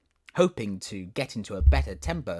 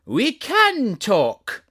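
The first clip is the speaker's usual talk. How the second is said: proud flower voice; 13 semitones higher, 11 dB louder, much slower than usual. Acted. Tiger lily's first reply.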